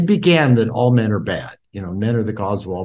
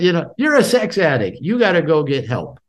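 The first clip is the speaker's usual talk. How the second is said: mock indignant, higher-pitched scolding; retold accusation; 6 semitones higher than usual. Wife confronting her husband about the affair, retold accusation. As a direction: imitate an upset wife laying down the law. Retelling wife's angry accusation.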